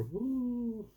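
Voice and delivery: very high falsetto woo; mock cheer. Dog owner faking bliss to everyone, mock high cheer.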